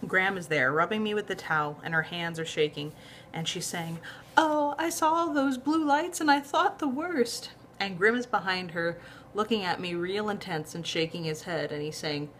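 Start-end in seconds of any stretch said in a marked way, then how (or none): 4.4-7.5 put-on high, slow shaky old grandma; grandma's voice. A narrator's reading. Graham fretting to Max after the police, high old voice.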